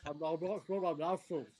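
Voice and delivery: talking with mouth full; mumbled through food. Real, not acted. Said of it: "Stop talking".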